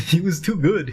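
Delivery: laughing, high praise. Real, not acted. Friend praising the maintenance dude, laughing. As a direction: giggle it out in delight.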